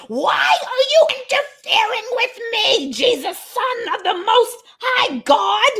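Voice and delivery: high, pleading demon cry; demon screaming. Acted. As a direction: beg Jesus like a tormented spirit, near tears. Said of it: "he screamed".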